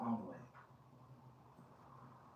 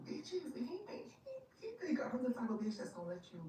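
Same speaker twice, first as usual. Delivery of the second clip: outraged squeal; 11 semitones higher than usual. Retold or imitated. Outraged high-pitched rant.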